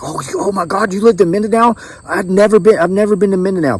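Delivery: put-on high, amazed Filipino-accented exclaiming; mimicked Filipino accent. Doctor amazed he lived in Mindanao, mimicked Filipino accent. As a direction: imitate an astonished Filipina doctor. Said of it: "I'm doing my best to mimic it".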